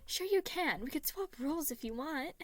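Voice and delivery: put-on high, eager reassuring child; calm them down quickly; soothing peacemaker. A narrator's reading. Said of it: "Patton quickly stepped in".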